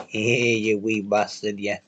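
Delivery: put-on Scots, taking the piss; mock Scottish accent. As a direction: drawl it in a jokey Scottish accent. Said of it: "taking the piss out of Scottish".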